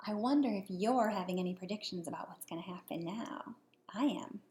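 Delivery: soft, hushed wondering; whispered aside. Real, not acted. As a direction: whisper the question to the listener. Whispered question to the child.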